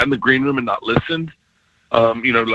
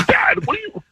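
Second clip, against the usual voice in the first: mock incredulous. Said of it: "I go".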